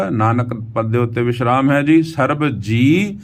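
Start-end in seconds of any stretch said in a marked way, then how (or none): 2.0-3.2 ਸਰਬ ਜੀ with the ਜੀ stretched long and slow; drawn-out ਜੀ. Shown as a demonstration. Teacher showing students how to recite ਸਰਬ ਜੀ, slowly stretching ਜੀ. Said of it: "ਜੀ ਨੂੰ ਥੋੜ੍ਹਾ ਜਿਹਾ ਖਿੱਚ ਕੇ ਬੋਲਣਾ ਹੈ".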